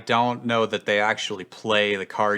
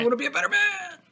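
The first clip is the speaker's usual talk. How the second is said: lightly voiced giant, pitched way up; a hint of a big lug reading his line; much faster than usual. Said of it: "the giant saying".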